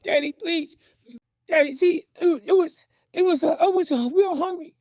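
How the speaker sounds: put-on high, halting child's plea; begging. Small boy to his angry father, begging. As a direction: stammer out excuses in a high voice. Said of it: "I'm already begging".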